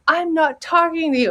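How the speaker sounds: a lightly voiced child turning her back; sulky little girl. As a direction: sulk like a hurt little girl. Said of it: "I talk to my little girl".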